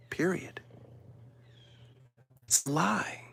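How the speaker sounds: hushed, breathy final word; whisper. Real, not acted. Closing his case to viewers, whispered.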